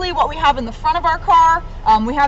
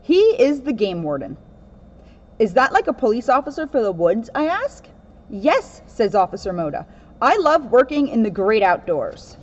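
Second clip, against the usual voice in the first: steady storybook read-aloud voice; reading a picture book; 2 semitones lower than usual. Acted. Officer reading a children's book to kids, storybook voice.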